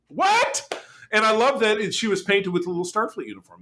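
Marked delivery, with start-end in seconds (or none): 0.1-0.8 very high disbelieving yelp; shocked what. Retold or imitated. The crew react to Peanut Hamper refusing, shocked and high. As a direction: squeak it out in shock. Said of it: "And you were like".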